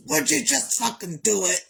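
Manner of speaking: loud exasperated mom, pitch up; mom snapping. Retold or imitated. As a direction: snap at the kids after five tries. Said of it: "the last time she goes".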